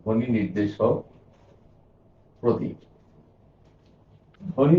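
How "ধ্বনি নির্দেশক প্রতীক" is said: spaced out word by word with long gaps, as if writing on the board; slow dictation. Shown as a demonstration. Teacher writing the definition for students, dictating slowly. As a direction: dictate each word while you write it. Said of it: "আমি লিখে দিই".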